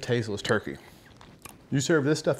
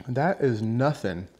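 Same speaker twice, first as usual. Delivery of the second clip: normal talking voice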